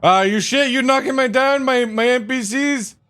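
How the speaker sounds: high, loud outrage; indignant yell. Acted. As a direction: squeal it in mock outrage. Player knocks down his NPCs.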